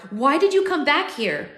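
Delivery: high, loud, agitated question; desperate demand. A narrator's reading. Narrator shaking his dying future self, desperate demand.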